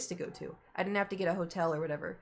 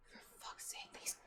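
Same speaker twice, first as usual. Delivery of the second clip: softer, whispered exasperated curse; 18 dB quieter than usual. Real, not acted. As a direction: hiss it under your breath. Annoyed aside while cat misbehaves.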